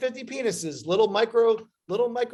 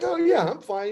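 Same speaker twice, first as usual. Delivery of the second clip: breezy mock nonchalance; 9 semitones higher, 4 dB louder than usual. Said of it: "I was like".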